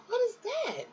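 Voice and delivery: puzzled. Retold or imitated. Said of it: "I'm like".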